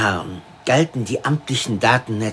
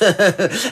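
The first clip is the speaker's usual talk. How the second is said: a short, high burst of laughter; loud laugh; 7 semitones higher, 4 dB louder than usual. Acted. Police chief laughs aloud after mocking Yat's dull job.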